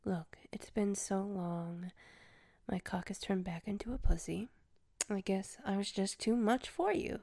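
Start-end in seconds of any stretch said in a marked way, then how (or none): 0.0-4.5 soft mocking whisper. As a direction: whisper to wake them. Waking captive softly.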